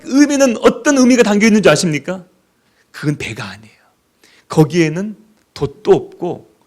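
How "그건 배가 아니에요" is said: whisper. Acted. Whispered point.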